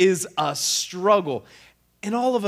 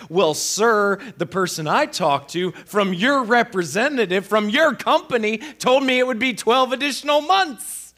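louder and higher, pressing the point hard; raised retold protest; 7 semitones higher, 5 dB louder than usual. Caller pushing back on the phone agent, raised and insistent. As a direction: insist firmly to the agent, voice rising. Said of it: "And I'm like".

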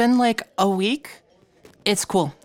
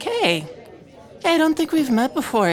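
bright squeaky sing-song welcome; 7 semitones higher than usual. Acted. May greets a stranger.

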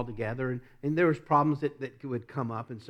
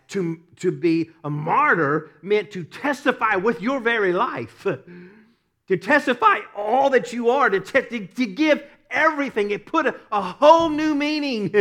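loud, rising, high-pitched sermon climax; preaching at full force; 7 semitones higher, 10 dB louder than usual. Acted. Preacher to congregation on martyrdom, building to full-force preaching.

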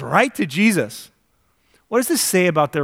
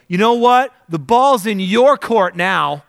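loud, higher, slow defiant throwdown; mock defiant challenge; 6 semitones higher, 6 dB louder than usual. Speaker telling God off after losing a job, loud and defiant. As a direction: throw it down like a dare. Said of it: "And I go".

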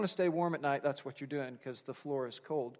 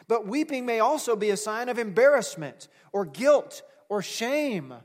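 louder, higher, driving sermon delivery; full-force preaching; 5 semitones higher, 6 dB louder than usual. Acted. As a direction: preach it out to the congregation with rising force.

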